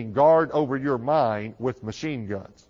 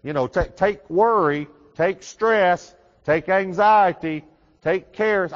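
louder and higher, hammed-up sendoff; mock a friend piling on the cares; playful exaggerated goodbye, louder and higher; mock farewell; 6 semitones higher, 6 dB louder, slower than usual. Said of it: "they say".

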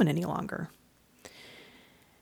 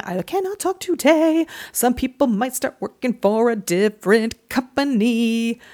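high, loud, exaggerated syllable-by-syllable delivery; mock over-enunciation; 5 semitones higher, 5 dB louder than usual. Host mocking own stumble, over-enunciating each word high and loud. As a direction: overdo every syllable after tripping on the words.

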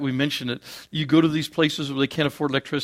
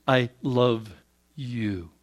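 softer, lower tender I love you; unconditional love; 4 semitones lower, 5 dB quieter, much slower than usual. Shown as a demonstration. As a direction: say it gently, fading on you.

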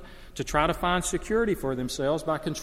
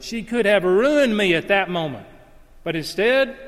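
much louder and higher preacher cry; E B Hill's delivery; 5 semitones higher, 7 dB louder than usual. Retold or imitated. Preacher echoing the pastor's funeral sermon: loud, high, ringing. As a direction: keep the ringing pulpit call going. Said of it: "He says that over and".

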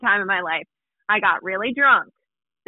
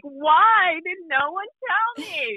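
high, slow dismayed wail; half-wailing at the mirror; mock-aghast cry; mock horror; 9 semitones higher, slower than usual. Sees beard in mirror.

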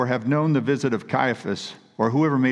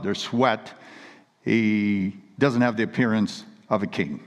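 ordinary delivery

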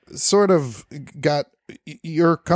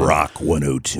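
deep, slow radio announcer voice; 10 semitones lower, slower than usual. Shown as a demonstration. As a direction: drop into the booming on-air DJ voice. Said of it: "There's that voice".

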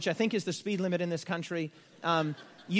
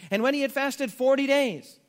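raised, louder reading of the verse; scripture reading; 4 semitones higher, 5 dB louder than usual. Acted. Preacher reading scripture to the church, raised and firm. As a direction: proclaim it to the room.

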